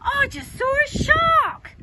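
high, slow, wonderstruck announcement; high-pitched, drawn-out marvelling; thrilled child. A narrator's reading. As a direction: draw it out in amazed excitement. Child amazed at his bucket.

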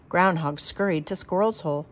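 usual speaking voice

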